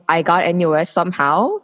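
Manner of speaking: ordinary talk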